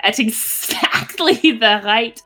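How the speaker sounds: ordinary delivery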